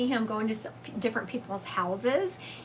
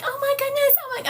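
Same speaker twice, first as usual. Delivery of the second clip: high-pitched, louder fretting nurse; flustered sweet nurse; 5 dB louder than usual. Retold or imitated. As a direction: imitate a dismayed nurse, pitched way up. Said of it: "the poor sweet nurse was like".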